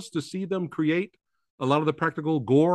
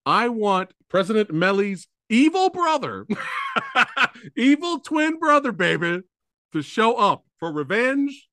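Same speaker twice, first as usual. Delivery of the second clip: hyped sequel pitch; 6 semitones higher, 3 dB louder than usual. Real, not acted. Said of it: "I'm throwing it out there".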